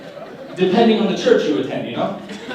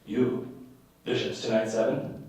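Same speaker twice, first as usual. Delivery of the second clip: lightly voiced terse bishop, low and soft; quiet mock bishop, pausing between words; bishop impression; 6 semitones lower, 10 dB quieter than usual. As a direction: drop low and give orders in fragments. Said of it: "did my best bishop impression".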